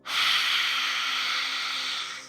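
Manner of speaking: long, airy exhale through the mouth; deep sigh. Shown as a demonstration. Guide demonstrates the exhale for meditators, long deep sigh. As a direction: let a slow breath stream out in a sigh. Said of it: "exhale for five seconds out of your mouth with a deep sigh".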